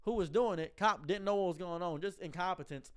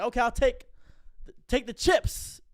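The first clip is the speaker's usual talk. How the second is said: robber voice; 6 semitones higher, 6 dB louder than usual. Retold or imitated. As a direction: blurt it out, stumbling over the words. Robber voiced, loud and slow.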